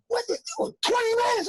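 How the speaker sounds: very high incredulous yell; outraged yell. Retold or imitated. Crew yelling at comic about short set, outraged. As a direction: scream it in disbelief.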